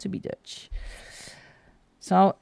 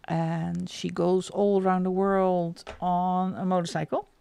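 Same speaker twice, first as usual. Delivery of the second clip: usual speaking voice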